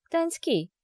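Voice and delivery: sly, silky opening to a friend; insinuating. A narrator's reading. Wife addresses her accomplice before revenge, insinuatingly. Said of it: "вкрадчиво начала я".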